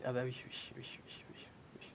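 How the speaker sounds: soft, fading mutter on spotting a mistake; muttering to oneself. Real, not acted. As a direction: trail into a whispered string of agreement. Mutters on seeing his error. (marmonné pour soi, presque chuchoté)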